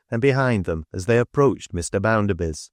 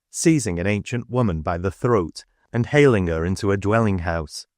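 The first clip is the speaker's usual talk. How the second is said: usual speaking voice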